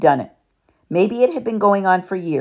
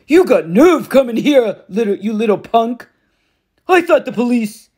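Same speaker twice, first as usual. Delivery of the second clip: higher, louder bullying stepfather; angry Gabe; 7 semitones higher, 5 dB louder than usual. A narrator's reading. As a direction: snarl at the returning stepson. Gabe voiced in narration.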